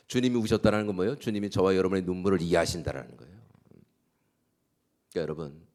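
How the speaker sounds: ordinary delivery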